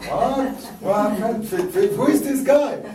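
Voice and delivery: high, loud incredulous exclamations in French-flavored English; American listeners stunned, high and loud; mock astonishment. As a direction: play shocked listeners hearing the French guy.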